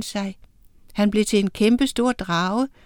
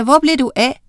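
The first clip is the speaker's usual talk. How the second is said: søgende, kaldende spørgsmål; råb; 4 semitones higher, 6 dB louder, faster than usual. A narrator's reading. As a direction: kald ud efter ham. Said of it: "råbte Miranda". (højt, undrende spørgsmål)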